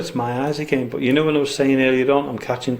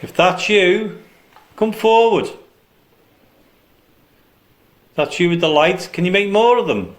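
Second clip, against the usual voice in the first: raised voice, calling into the dark; 5 semitones higher, 3 dB louder than usual. Real, not acted. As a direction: call out to an unseen presence. Calling out to spirits.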